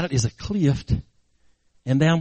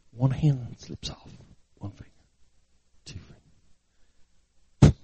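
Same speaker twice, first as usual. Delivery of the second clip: hushed suspense; 5 semitones lower, 5 dB quieter than usual. A narrator's reading. Preacher narrating the boy's slipping grip, hushed. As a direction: count it out barely audibly.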